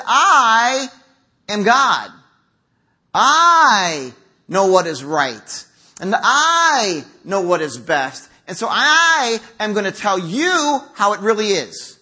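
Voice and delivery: drawn-out, swelling emphasis on I; pompous self-righteousness. Acted. Churchgoer judging a neighbor as if God, pompous. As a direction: play a self-appointed God, lording it.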